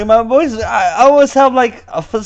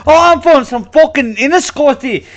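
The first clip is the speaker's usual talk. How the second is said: higher, laughing fake Irish brogue; mock Irish accent; 9 semitones higher, 5 dB louder than usual. Host showing the fake Irish voice he claims not to use, mock Irish. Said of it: "I don't pick up some fake".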